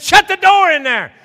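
loud, high, drawn-out holler; urgent yell. Retold or imitated. Husband hollering to wife about the kitchen door, urgent. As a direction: shout an urgent order to another room, slowly stretched.

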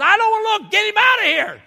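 high, loud mock wife outburst; alarmed refusal. Wife refusing to look at husband's dead rat, alarmed. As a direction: imitate a wife refusing in a high panicky pitch.

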